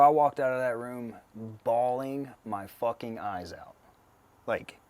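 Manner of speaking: normal talking voice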